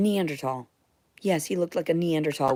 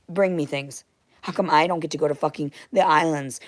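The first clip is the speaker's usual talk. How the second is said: usual speaking voice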